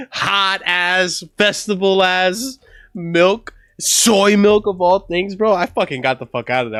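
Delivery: normal talking voice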